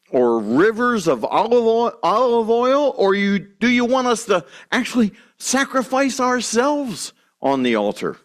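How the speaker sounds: high, whining complaint; whiny voice. Retold or imitated. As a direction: whine it like a spoiled child. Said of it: "asking in a whiny voice".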